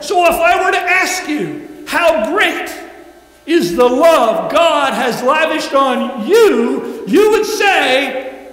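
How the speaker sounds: loud, high preaching call; rally the congregation at full voice; preaching shout. Real, not acted.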